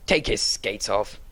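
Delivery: low, slow stern command. A narrator's reading. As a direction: lay down the order gravely. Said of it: "said in a stern voice".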